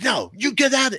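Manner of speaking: high, loud order to leave; imitated angry outburst; retold yelling. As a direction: yell it like a heated argument. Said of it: "they're yelling and cursing".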